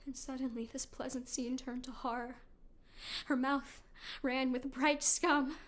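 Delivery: low, soft and hushed. Acted. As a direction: draw them in quietly. Calm before the horror.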